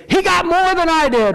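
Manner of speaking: put-on high-pitched kid complaint; whining little kid. Little kids keeping score, whiny high voice. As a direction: imitate a child protesting unfairness, way up high. Small kids comparing portions.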